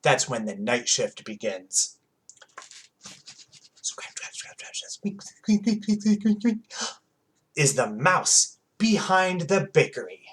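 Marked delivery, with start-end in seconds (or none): 3.8-4.9 whispered scratching. A narrator's reading. Mouse noises whispered in story. 4.9-6.6 high squeaks. A narrator's reading. Mouse squeaks voiced in story.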